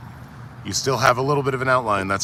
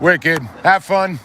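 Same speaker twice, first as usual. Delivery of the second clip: bright send-off; 8 semitones higher, 9 dB louder, slower than usual. Real, not acted. Cheerful goodbye to departing friend.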